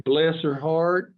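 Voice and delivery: Southern bless her heart. Shown as a demonstration. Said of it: "They say".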